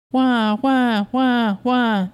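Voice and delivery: mock baby wail. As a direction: wail like a baby pulled off the bottle. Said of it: "the baby yelled".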